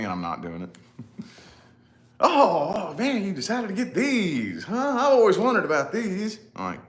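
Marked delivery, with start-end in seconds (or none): 2.2-6.4 high, loud gushing small talk; mock the overfriendly lady's gush; chatty lady. Friendly lady chatting in line, high and loud. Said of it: "she's trying to entice small talk".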